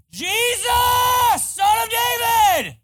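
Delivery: full-force, high-pitched shout; top-of-lungs scream. Acted. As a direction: scream it out to be heard over a crowd. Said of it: "He's screaming at the top of his lungs".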